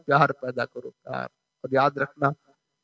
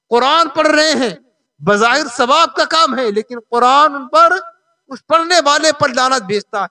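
raised, emphatic preaching voice; full-force preaching; 7 semitones higher, 5 dB louder than usual. Real, not acted. Preacher warning careless Quran readers, forceful sermon. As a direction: hammer out each condition to the congregation. Preacher exhorts on correct recitation.

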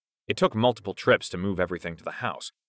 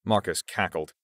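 ordinary delivery

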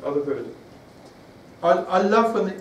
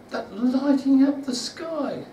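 awed wonder; 9 semitones higher, slower than usual. Real, not acted. Awed image of shepherds' sky.

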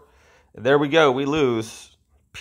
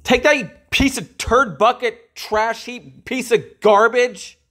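angry taunt; 11 semitones higher than usual. Real, not acted. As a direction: hurl the insults high at the screen. Gamer raging at enemy.

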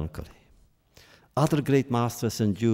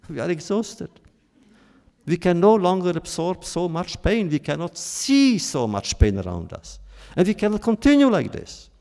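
impassioned plea; 7 semitones higher, 4 dB louder than usual. Retold or imitated.

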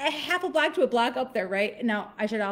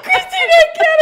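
very high, loud mock wail; imitated panicked howl; wailing mom; 14 dB louder than usual. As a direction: scream it helplessly, hammed up. Retelling mom's loud wailing.

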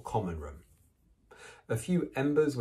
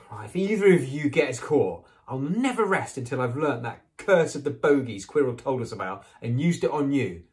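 loud, higher, emphatic warning; heated, forceful ultimatum; furious threat; 5 semitones higher, 7 dB louder than usual. A narrator's reading. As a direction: threaten them crossly. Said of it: "glared furiously at Hermione and Neville".

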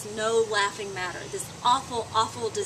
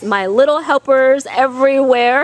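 loud call with rising end; shouting; 2 semitones higher, 14 dB louder than usual. Real, not acted. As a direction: shout it to the crowd. Calling out to kids.

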